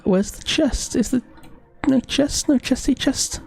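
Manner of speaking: high, pleading voice looking for the chest; high-pitched search. Real, not acted. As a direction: squeak it out, hunting for treasure.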